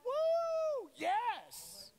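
high, loud joyful whoop; excited cheer. Retold or imitated. Jesus cheering Peter on, excited. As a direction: cheer like a proud coach. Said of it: "I think Jesus was like".

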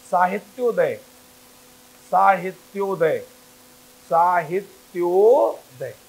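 teacher drawing out साहित्योदय for the class; syllable by syllable. Shown as a demonstration. Teacher to students, slow stretched साहित्योदय. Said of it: "शब्द लिख रहा है तुम्हारा".

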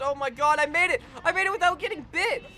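high, fast cry of victory; excited triumph. Real, not acted. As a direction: celebrate the win out loud. Triumph after passing hard part.

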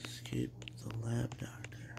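hushed whisper to the viewers. Real, not acted. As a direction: whisper it so nobody hears. Streamer whispering during game.

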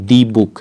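the book with "the" said "dee"; wrong "dee" article. Shown as a demonstration. Teacher showing learners what not to say, "dee book". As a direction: mispronounce "the" as "dee" before book. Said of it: "not the book।".

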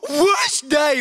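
high sulky whine; mock whine of self-pity; whining. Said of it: "While he's busy whining".

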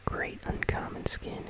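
usual speaking voice

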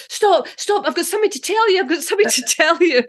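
high, hammed-up pleading to the dentist; mock panicked child. Child pleading with the dentist before the mask, mock panic. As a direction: beg him to stop, laughing through the end. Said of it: "you're going".

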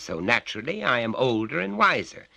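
loud, high lecturing father; stern father. A narrator's reading. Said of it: "said his father".

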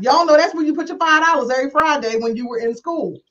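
ordinary talk, nothing marked

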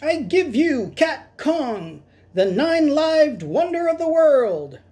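high, slow showman flourish; grand squeaky unveiling. Acted. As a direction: present the wonder with a tiny showman's pride. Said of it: "he squeaked".

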